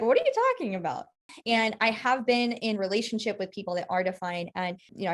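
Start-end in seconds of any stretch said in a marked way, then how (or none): 0.0-1.1 incredulous. Retold or imitated. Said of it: "they're like".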